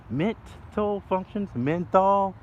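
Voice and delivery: rising into an excited rant, voice climbing high. Real, not acted.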